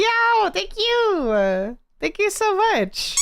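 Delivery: high, slow delighted squeal; excited squeal. Real, not acted. Fan praises art.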